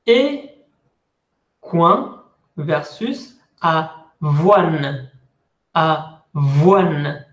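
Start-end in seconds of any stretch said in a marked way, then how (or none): 2.6-7.3 "avoine" slowly, said avwan. Shown as a demonstration. Teacher drills the word for students, slow. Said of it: "La E no suena".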